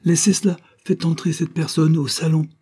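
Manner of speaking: nervous judge snapping at the maid. A narrator's reading. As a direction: repeat it sharper, losing patience. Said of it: "fit monsieur Duranton impatienté, nerveux".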